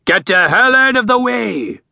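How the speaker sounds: bellowing order to clear the way; shouting at the crowd to move; bellowed. A narrator's reading. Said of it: "a bellowed".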